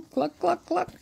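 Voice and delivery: high playful hen clucks; clucking. A narrator's reading. Reading a picture book, playful high chicken clucks. Animal noises in the bank.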